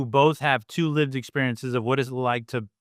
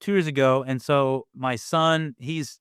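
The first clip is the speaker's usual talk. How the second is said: normal talking voice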